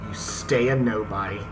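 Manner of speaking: usual speaking voice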